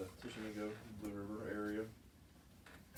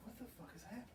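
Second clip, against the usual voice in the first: high, hushed baffled mutter; 11 semitones higher, 11 dB quieter, much faster than usual. Retold or imitated. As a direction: ask it to yourself, confused and high. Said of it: "I'm like".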